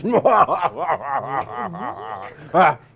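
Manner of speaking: loud, high jolly guffaw; booming belly laugh. Acted. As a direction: laugh big like a fat host. Mayor laughs at own joke.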